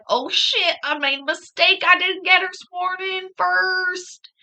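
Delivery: high, loud mock realization of a blunder; imitating the panicked lawyer. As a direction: mimic her dismay, stretching the end out. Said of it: "She's like".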